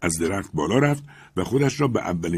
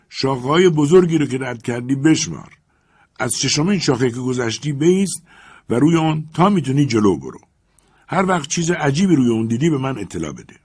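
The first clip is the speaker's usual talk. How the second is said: loud, raised master calling up to his servant; Legrand calling up; 6 semitones higher, 6 dB louder than usual. Acted. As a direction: project up into the tree, firm and eager.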